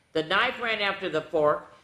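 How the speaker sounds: plain reading voice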